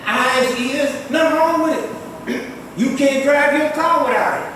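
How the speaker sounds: normal talking voice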